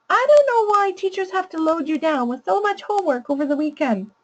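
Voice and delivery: put-on a whiny kid's gripe pitched high; high child voice. Shown as a demonstration. Educator voicing the child's homework gripe, high. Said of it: "I'll use a really high voice to read the child's problem".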